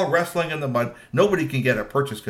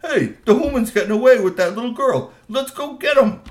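much higher and louder call to chase; rallying shout; 11 semitones higher, 8 dB louder than usual. Acted. Teen trolls shouting to chase the runaways. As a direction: rouse the gang to give chase. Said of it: "teen trolls were not gonna let that happen".

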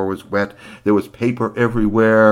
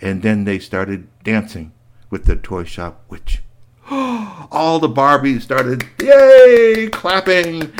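ordinary delivery

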